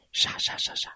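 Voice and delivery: soft unvoiced rustling sound; whispered rustle. A narrator's reading. Narrator rustling bushes in a hushed breathy hiss.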